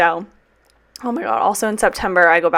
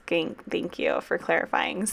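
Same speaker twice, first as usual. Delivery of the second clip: sarcastic mutter; 7 dB quieter than usual. Real, not acted. Sarcasm at school emails.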